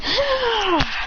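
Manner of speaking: high, long "aah"; mock joyful yell. Yell while jumping on.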